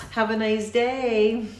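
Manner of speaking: passive-aggressive goodbye. Retold or imitated. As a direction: sound nice but mean it not. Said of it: "That is a passive-aggressive message".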